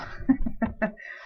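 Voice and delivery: a quick breathy chuckle; short laugh. Real, not acted. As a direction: laugh briefly at yourself. Laughs at own advice.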